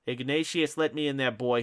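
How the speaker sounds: mother screams at her son's locked door; screaming. Acted. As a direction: yell through his door. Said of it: "she screamed".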